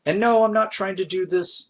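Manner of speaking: ordinary talk, nothing marked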